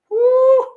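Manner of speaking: high, loud whooping exclamation; loud whoo. Real, not acted. Imagining fighting Vic for real, loud high whoo. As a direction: let out a big whoo.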